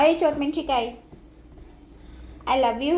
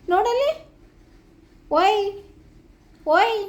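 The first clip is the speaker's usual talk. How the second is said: higher calling out to the pet; high calling coo; 7 semitones higher, slower than usual. Real, not acted. Owner trying to make pet look, high calls. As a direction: call up high to get her attention.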